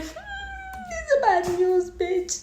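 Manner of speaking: high, put-on voice of sassy doom; sassy dread. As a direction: camp it up, drag the Hmm way up. Reacting to doctor's dodge.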